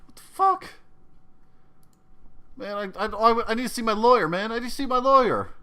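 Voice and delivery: high, loud, desperate demand for a lawyer; begging for rights; terrified demand. Acted. Fred begging his captor for a lawyer, high and desperate. GM voices the panicking captive.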